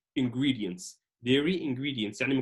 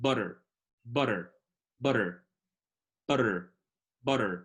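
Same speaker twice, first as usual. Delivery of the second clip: pure American accent; 4 semitones lower than usual. Shown as a demonstration. Teacher drilling American pronunciation, butter with flapped T. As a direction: say butter the American way, T as a quick tap. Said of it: "باللهجة الأمريكية الخالصة بتكون butter".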